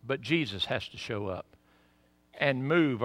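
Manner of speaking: ordinary delivery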